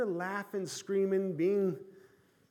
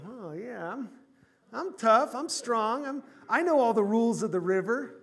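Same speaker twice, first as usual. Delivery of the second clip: mock-proud, louder boasting about himself; self-mocking brag, raised and loud; cocky boast; 5 semitones higher, 6 dB louder than usual. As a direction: puff up like a know-it-all swimmer. Preacher mocks his cocky self.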